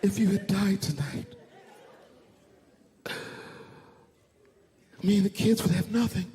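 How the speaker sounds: mock tearful wail. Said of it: "She said".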